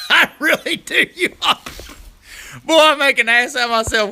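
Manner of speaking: laughing admission to viewers. Real, not acted. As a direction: laugh through it at your own clumsiness. Laughing at himself.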